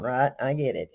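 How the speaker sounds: ordinary delivery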